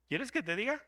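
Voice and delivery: playful knowing tease; teasing challenge. Shown as a demonstration. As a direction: dare them to hear it.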